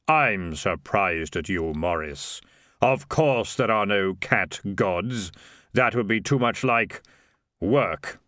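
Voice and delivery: slow, measured, hollow delivery of Death; Death, slow and grave. Acted. Death tells Morris the sum is correct, slow and grave. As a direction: weigh every word like the reaper.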